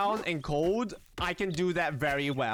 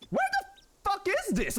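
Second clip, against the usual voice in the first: startled. Real, not acted. Sudden alarm in game.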